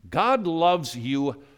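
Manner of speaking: slow, weighty God loves you; slow emphasis. Real, not acted. Preacher telling believers, slow and weighty. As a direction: lean on each word of God loves you.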